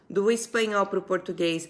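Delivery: ordinary talk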